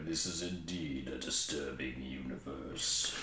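low, slow, soft deadpan cat voice; mock-ominous pronouncement from a cat; solemn talking cat. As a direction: intone it gravely, deep and drawn out. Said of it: "one of those things the cat's gonna look at".

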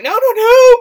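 hammed-up alarm pulling him back; frantic no. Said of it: "And Father's like".

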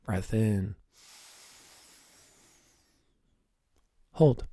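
calm, soft breathing cues. Acted. Leads a breathing exercise.

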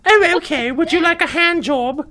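very high, loud British lady voice; British woman. Acted. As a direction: squeal it like a hammy British woman. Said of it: "to a British woman".

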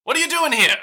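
a frightened little woman pulling them inside fast; panicked gnome. Acted. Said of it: "seems, like, panicked".

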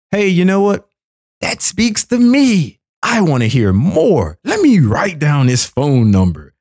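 high, excited mock convert; mock eager viewer. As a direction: gush like a hooked ad viewer. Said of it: "you work with people".

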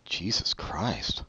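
breathy, slow muttered disbelief; exasperated whisper. Real, not acted. Podcast host hearing a generous review score, slow whispered exasperation. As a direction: whisper it under your breath in dismay.

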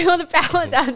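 echoing it through laughter; laughing repeat. Real, not acted. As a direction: laugh the words out.

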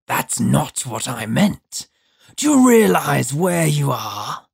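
slow, grating, menacing creature; harsh demon voice. A narrator's reading. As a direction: question him slowly in a harsh voice. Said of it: "harsh as pumice on broken glass".

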